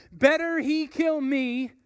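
loud, firm declaration; burn with conviction; David answering with fiery resolve; blazing with fire. Acted. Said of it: "David's answer that blazed with fire".

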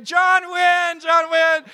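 high, loud joyful calling of the name; crowd yelling. Retold or imitated. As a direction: shout it out like villagers greeting a hero. Villager shouting the name.

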